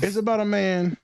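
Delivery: high, silly put-on voice; funny voice. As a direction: pitch it up for laughs. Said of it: "You just said it in a funny voice".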